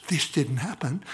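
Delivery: normal talking voice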